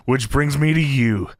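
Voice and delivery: drawn-out mock seduction; slow, hammed-up come-on; slow seductive promo. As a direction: linger suggestively on every word. Voicing Viscera's seductive promo.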